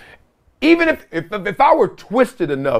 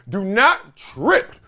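slow warning; 2 semitones higher, much slower than usual. Real, not acted.

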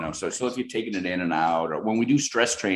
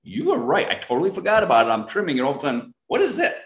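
high, quick, thrilled; excited admission; 13 semitones higher, 8 dB louder than usual. Retold or imitated. Friend calling after finding the duct tape, thrilled and high. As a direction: gush with surprised delight.

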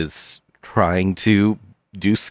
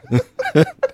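high squeaky giggle. Acted.

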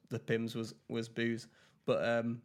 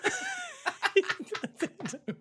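loud, very high laugh; real laugh at being teased; high burst of laughter; 6 dB louder than usual. Real, not acted. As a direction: caught out and cracking up, very high.

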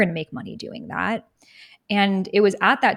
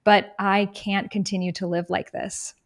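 usual speaking voice

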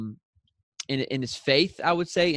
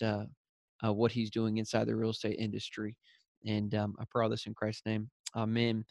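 softer, hushed prayer voice; 2 semitones lower, 5 dB quieter than usual. Real, not acted. Host praying over the interview with the guest, quiet prayer. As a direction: settle down and pray quietly.

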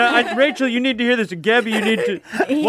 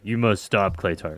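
a deep, lowered command to stop; mock stern deep voice; 13 semitones lower, 6 dB quieter, slower than usual. A friend mimicking the host ordering Clayton to stop, in a deep mock voice. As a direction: drop the voice way low for the mock order. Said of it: "He was like".

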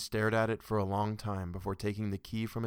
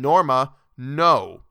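much louder, cutting her off; shouted refusal; 5 semitones higher, 15 dB louder, much slower than usual. Acted. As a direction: shout her name to stop her. Arthur refuses again, pale.

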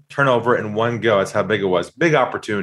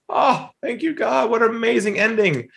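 high, breathless thank-you to God; a big, high sigh of relief; relieved delight; 8 semitones higher than usual. Retold or imitated. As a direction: gush with relief, pitched way up. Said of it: "I said to myself".

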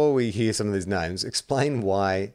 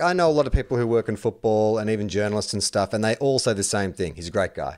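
normal talking voice

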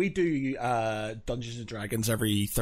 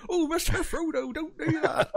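high, laughing Samwise impression; pleading Samwise, much higher pitch. Shown as a demonstration. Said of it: "kept on popping into".

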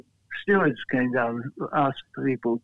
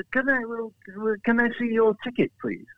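higher pitched polite official; lightly voiced official asking for a ticket; a hint of a fussy UEFA man; 7 semitones higher than usual.

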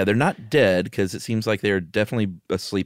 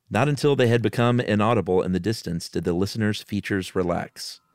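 ordinary delivery, nothing marked

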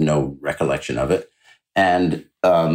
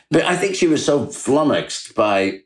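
usual speaking voice

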